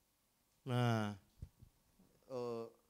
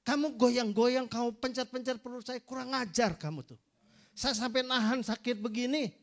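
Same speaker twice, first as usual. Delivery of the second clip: raised, higher furious telling-off; angry scolding; 6 semitones higher, 7 dB louder than usual. Retold or imitated. Pastor scolding rude students for pressing his belly, angry and loud. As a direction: scold them like an offended elder pastor.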